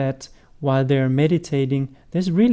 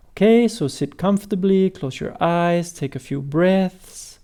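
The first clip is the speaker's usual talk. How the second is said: gradually slower, longer pauses, softening at the end; slowing down. Shown as a demonstration. Teacher showing how to guide people into meditation, gradually slowing down. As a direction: start conversational, ease into slow meditation guiding. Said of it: "you might slow down how you speak".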